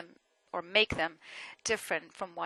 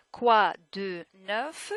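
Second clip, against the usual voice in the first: "Quoi de neuf" one word at a time; louder, drawn-out "Quoi de neuf"; slow, separated words; 7 dB louder, much slower than usual. Shown as a demonstration. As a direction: spell out "Quoi de neuf" slowly with a full "de". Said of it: "It's not fast, it's just that French people do not slow down".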